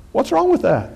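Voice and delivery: high-pitched rhetorical challenge; mock protest. Preacher defends husband and wife, high mock-incredulous protest. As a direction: toss out the question in mock disbelief. Mock argument about being naked.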